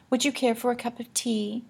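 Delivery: genteel lady voice. Acted. Annabelle offers tea.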